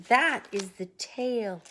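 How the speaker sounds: plain reading voice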